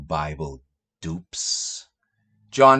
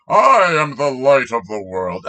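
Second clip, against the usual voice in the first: louder, grandiose declaration; mock pompous quote; 7 dB louder than usual. Parodying Jesus' claim, loud and pompous. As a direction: boom it out self-importantly. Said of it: "he turns around".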